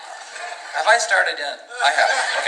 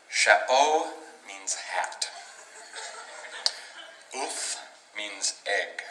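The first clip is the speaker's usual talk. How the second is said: whispered; 4 semitones lower, 10 dB quieter, much slower than usual. Real, not acted. Whispered example.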